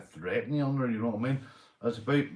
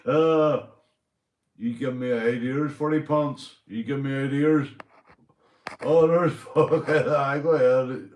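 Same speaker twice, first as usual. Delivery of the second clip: excited, laughing; 4 semitones higher, 7 dB louder than usual. Real, not acted. Laughing at a chat user's idea.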